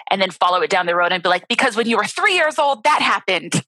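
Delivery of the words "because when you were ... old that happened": inner analyst voice. Shown as a demonstration. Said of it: "now I kinda do it like that".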